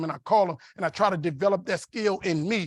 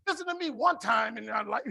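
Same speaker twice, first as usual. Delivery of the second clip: higher voice tipping into laughter; mock exasperated plea; 5 semitones higher than usual. Husband begging wife to listen just once, mock exasperation. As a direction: beg comically to be heard for once.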